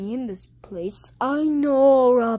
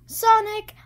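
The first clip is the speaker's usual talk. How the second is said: Sonic voice; 8 semitones higher, 5 dB louder than usual. Acted. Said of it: "I don't know why I talked like him".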